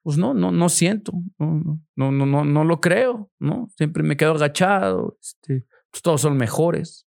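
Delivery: high, whiny voice of someone who feels worthless; whiny devalued self. Retold or imitated. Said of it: "así como".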